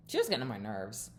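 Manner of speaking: ordinary talk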